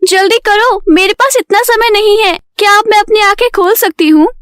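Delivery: high-pitched, hurrying customer; impatient Payal. Acted. Payal waits with eyes shut for the stylist, impatient.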